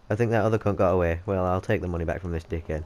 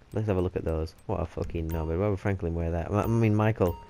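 ordinary delivery

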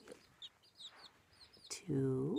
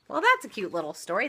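ordinary delivery, nothing marked

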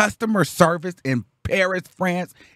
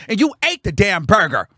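higher, louder, punched-out accusation; indignant accusation; 5 semitones higher, 5 dB louder than usual. Retold or imitated. Arguing with the man who ate it, indignant. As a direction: throw it back at him, worked up.

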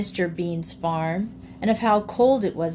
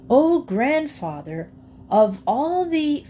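higher, slow, ceremonious salutation; eager formal address; 7 semitones higher, 4 dB louder, slower than usual. A narrator's reading. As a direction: begin a grand, respectful address. Freddie formally addresses the old alligator.

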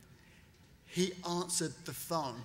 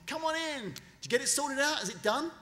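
higher, upbeat and keen; eager welcome; 8 semitones higher than usual. Retold or imitated. Trump welcoming Mark in to hear the deal is done, eager. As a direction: hurry him in for the good news.

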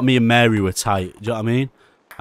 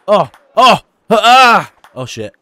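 normal talking voice

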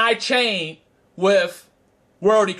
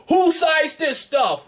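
incredulous ref; 7 semitones higher, slower than usual. Retold or imitated. Ref amazed at side deck.

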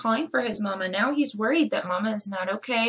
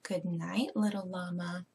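tender goodnight; 4 semitones lower, 7 dB quieter than usual. Acted. Mama says good night to baby.

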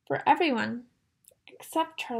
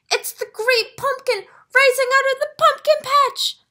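much higher, louder thrilled shout; boy shouting as the shape rises; excited yelling; 11 semitones higher, 8 dB louder than usual. A narrator's reading. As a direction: cry out in wonder. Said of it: "Linus yells".

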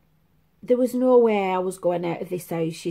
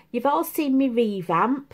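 ordinary talk, nothing marked

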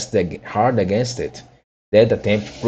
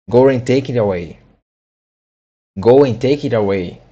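louder, firm command; mild angry order; 4 dB louder than usual. A narrator's reading. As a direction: order the servant off. Rabbit orders Pat.

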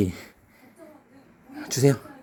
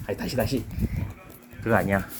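normal talking voice

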